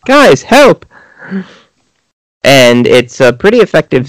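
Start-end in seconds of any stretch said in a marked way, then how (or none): 0.1-0.9 high-pitched mock panic; panicked call for help. Film character snapping out of it in the airlock, panicked.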